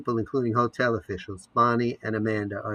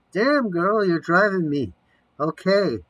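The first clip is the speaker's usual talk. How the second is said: startled outburst; 8 semitones higher, 6 dB louder than usual. Acted. Amanda startled, answering off screen.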